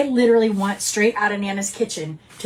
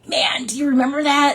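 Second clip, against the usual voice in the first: higher, eager acted question; animated shared-memory excitement; excited reminiscing; 6 semitones higher than usual. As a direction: ask an old friend with raised-pitch enthusiasm. Retold excited call to old friends.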